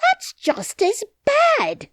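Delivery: very high, slow shrieking nurse voice. Acted. Nurse answers the silent princess, high and slow. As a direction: squeal it out indignantly.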